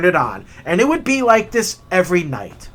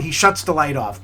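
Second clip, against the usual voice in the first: ordinary talk, nothing marked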